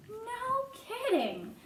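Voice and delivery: high, long-drawn amazement; impressed disbelief. A narrator's reading. Boy almost believing the mouse, amazed. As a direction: stretch it out high in wonder.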